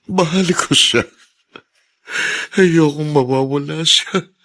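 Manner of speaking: choked, desperate love; tearful plea. Acted. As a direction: voice rising high with held-back tears. Wife hospitalized, husband pleads alone.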